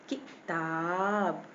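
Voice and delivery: किताब with a quick "ki", then a long "taab"; quick short vowel. Shown as a demonstration. Teacher showing the short i matra, quick first syllable. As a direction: clip the ki of किताब, stretch the taab. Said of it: "ये जल्दी से निकल गया".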